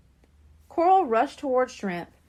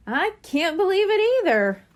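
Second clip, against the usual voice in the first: higher and louder; amazed; 8 semitones higher, 5 dB louder than usual. A narrator's reading. Coral answers her brother, amazed and high. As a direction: marvel out loud.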